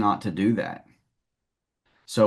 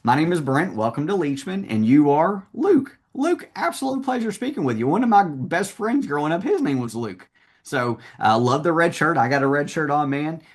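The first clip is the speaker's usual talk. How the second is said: upbeat, higher-pitched meet-and-greet pitch; salesman's greeting; 6 semitones higher than usual. Shown as a demonstration. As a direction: greet the customer eagerly, pitch lifted. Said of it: "that's how I would attack it".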